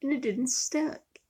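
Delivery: high, incredulous questioning; puzzled viewer. Retold or imitated. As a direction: voice the doubtful viewer. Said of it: "you're probably wondering".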